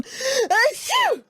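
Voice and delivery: high sneeze cutting off the request; acted sneeze. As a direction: fake a sudden high achoo. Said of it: "if they sell achoo here".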